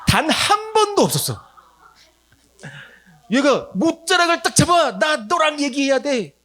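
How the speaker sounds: a child's urgent plea, voiced high and slow; astonished high voice. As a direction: tell it like you still can't believe it. Boy grabs hem, astonished telling.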